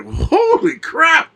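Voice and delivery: slow, drawn-out high "Holy crap"; watching the crocodile video, slow amazed exclamation; stunned. Retold or imitated. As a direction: stretch it out in disbelief. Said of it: "I was like".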